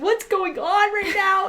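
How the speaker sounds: high, slow mock wail; drawn-out, exaggerated upset question; crying bewilderment. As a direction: imitate baffled crying. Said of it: "I started crying".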